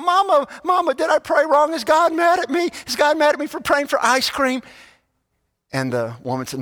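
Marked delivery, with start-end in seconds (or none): 0.0-4.6 put-on high, pleading child's voice; worried little boy. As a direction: cry out to mama like a scared kid. Boy runs to mama, worried.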